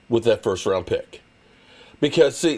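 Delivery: normal talking voice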